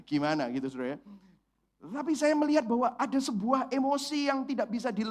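ordinary talk

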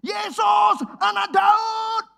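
high, loud begging shout, slow on Daud; the blind beggar yelling over the crowd, desperate. Retold or imitated. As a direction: scream for help as if it's your last chance. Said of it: "Dia teriak, teriak, teriak".

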